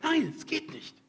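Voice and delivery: high, loud anguished refusal; retold desperate cry. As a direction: cry out against God's call. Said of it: "Ich hab gesagt".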